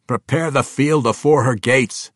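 loud, high dwarvish command; dwarf king instructing. A narrator's reading. Bruenor instructing his fellow kings, loud and commanding. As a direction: give the order as a dwarf commander. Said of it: "Bruenor instructed".

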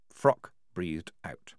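plain reading voice

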